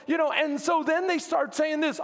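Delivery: usual speaking voice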